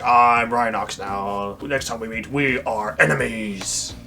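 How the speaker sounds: low, slow villain voice; Rhinox's menacing vow. Retold or imitated. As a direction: draw it out deep and ominous. Rhinox vows enmity, acted.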